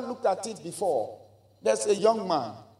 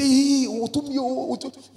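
put-on fervent exclamation; imitated excited churchgoers; 5 semitones higher than usual. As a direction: mimic worshippers crying out. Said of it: "And they say".

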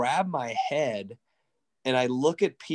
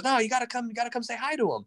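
higher, urging him along; eager insisting; 9 semitones higher, faster than usual. Retold or imitated. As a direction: push him to come say hi. Peter insisting in retold story.

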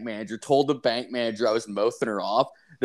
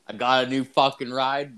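normal talking voice

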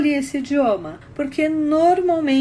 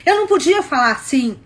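louder, firm and cross; demonstrated anger; 5 dB louder than usual. Shown as a demonstration. Woman showing the tone her boyfriend disliked, demonstrated anger. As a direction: show the forbidden cross tone, moderately. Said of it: "Eu não podia ficar com raiva".

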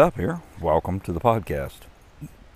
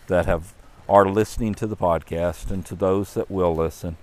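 ordinary delivery, nothing marked

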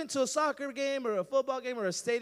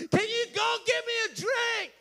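shouting over noise; 10 semitones higher, 6 dB louder than usual. Acted. Friend yelling across a packed stadium, shouted. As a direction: shout it higher and loud over a roaring crowd.

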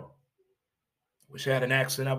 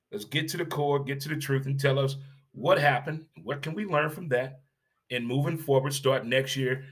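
ordinary talk

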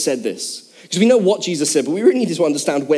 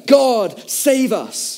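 higher, drawn-out crowd cry; mock crowd shouting; 5 semitones higher, much slower than usual. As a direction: imitate worshippers calling out, slowly. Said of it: "they would start shouting to each other".

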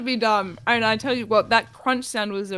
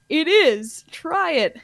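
radio ad spokesperson; 7 semitones higher, 6 dB louder, slower than usual. Acted. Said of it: "two people in conversation".

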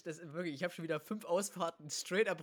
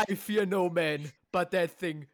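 loud, slow dramatic movie line; loud meme quote; 11 dB louder, slower than usual. Acted. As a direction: boom it out like a trailer. Quoting a meme.

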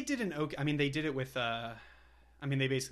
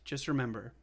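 usual speaking voice

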